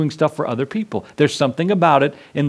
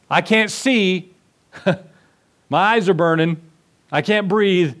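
slow, higher, strained voice; choking plea; 4 semitones higher than usual. Acted. Believer blinded by smoke cries to the Lord, slow and strained.

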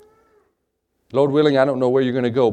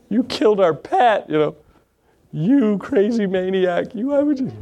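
put-on higher, slow whiny children's accusation; upset kids; 7 semitones higher than usual. Kids accusing dad of killing their pet rat, higher whiny. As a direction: wail it like a heartbroken kid.

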